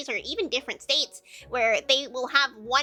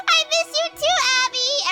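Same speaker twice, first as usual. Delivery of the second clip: squealing high and loud "I miss you too"; excited greeting; 8 dB louder than usual. Real, not acted. Streamer greeting viewer Abby, excited high squeal. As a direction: gush it out much higher and louder.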